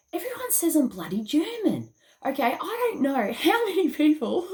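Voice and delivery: high-pitched, exasperated disbelief. Real, not acted. Exasperated about being called German.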